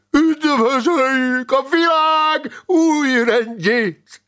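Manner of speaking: high, slow, loud chant-like howl; howled proclamation. A narrator's reading. Grand master summoning all to Lazarus's people, howled proclamation. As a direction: stretch every word into a fervent roar. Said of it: "üvöltötte Szent Lázár lovagrendjének".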